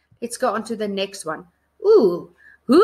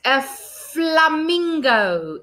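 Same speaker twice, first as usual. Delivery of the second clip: slow; 5 semitones higher, much slower than usual. Shown as a demonstration.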